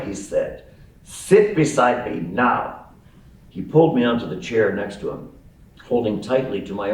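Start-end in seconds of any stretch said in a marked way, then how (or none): 1.0-2.8 put-on slow, commanding German accent; thick German accent. Old man ordering a boy to sit, thick German accent. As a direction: order him slowly, heavily German-accented. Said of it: "spoke in a thick German accent".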